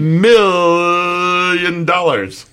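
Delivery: very slow, high, loud stretched words; drawn-out exclamation. Pretend. Echoing the million dollars joke, slow grand drawl. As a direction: stretch each word out grandly.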